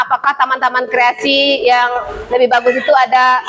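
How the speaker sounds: ordinary delivery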